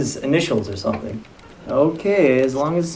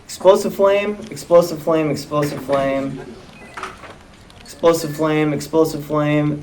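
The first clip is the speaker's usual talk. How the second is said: monotone, trailing down softer with each repeat; deadpan script reading; 5 dB quieter than usual. Acted. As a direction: drone the repeated move off the page. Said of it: "Let's see, it says".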